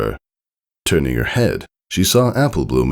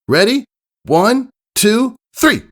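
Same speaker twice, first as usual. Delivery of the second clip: high, loud tomboy countdown; race countdown call; 3 dB louder, slower than usual. Acted. Starting a race.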